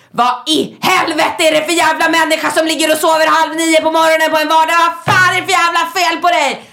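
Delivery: high and loud, furious tirade; yelling at a boyfriend who overslept; angry yelling. Retold or imitated. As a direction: tear into him for sleeping. Raging at sleeping partner on phone.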